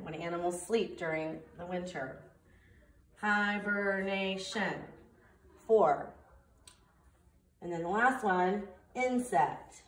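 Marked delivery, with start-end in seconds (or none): 3.2-4.7 hibernation said slowly, each syllable separate; slow syllable count. Shown as a demonstration. Teacher counting syllables for students, slow and drawn out. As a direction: draw out hibernation beat by beat. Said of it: "We'll do this one".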